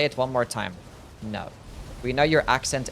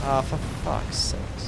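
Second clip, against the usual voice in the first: slow, voiceless sigh of frustration; drawn-out whispered swearing; exasperated whisper; slower than usual. Real, not acted. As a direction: breathe the curse out, defeated. Exasperated after repeated boss attack.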